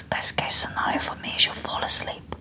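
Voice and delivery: soft imitated whisper, Spanish accent; hushed Spanish accent. Spanish masseuse settling a client, hushed accented whisper. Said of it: "like, this beautiful accent".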